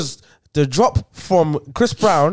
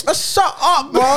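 much higher-pitched comic outrage; exasperated dismissal; 9 semitones higher than usual. Real, not acted. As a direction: wave the argument away, voice shooting up. Cutting off the friends' argument.

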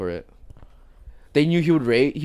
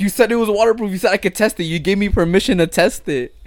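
raised, louder self-defence; protesting; 8 semitones higher, 5 dB louder than usual. Retold or imitated. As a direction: argue back insistently, high and loud. Acted argument over the broken speaker.

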